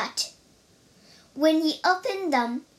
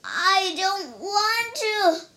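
high, slow, drawn-out whine; stubborn bear's protest, stretched out; whining refusal; 6 semitones higher, slower than usual. A narrator's reading. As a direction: dig in like a stubborn bear. Bear refuses Guan Yin's order.

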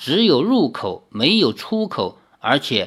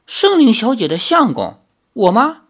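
higher, louder startled question; taken aback; 7 semitones higher, 4 dB louder than usual. Acted. Li Xiaoyao stunned to hear he's the husband, higher and louder. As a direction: catch him off guard. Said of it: "李逍遥一愣".

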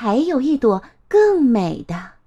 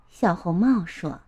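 ordinary delivery

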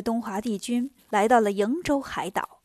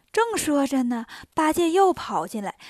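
plain reading voice

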